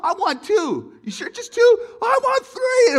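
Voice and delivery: very high, loud eager shouts; excited kids. Retold or imitated. Kids shouting their orders at dad, very high and excited.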